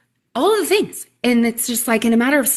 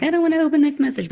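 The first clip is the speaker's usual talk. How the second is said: mock panic; 7 semitones higher, much faster than usual. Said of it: "I was a little panicky".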